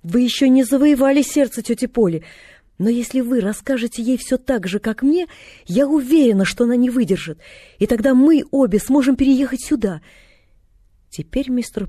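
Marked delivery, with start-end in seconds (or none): 0.0-10.0 put-on higher, louder girl, confidently planning; child persuading with lively certainty; eager young girl. A narrator's reading. Girl planning her aunt's move, eagerly confident.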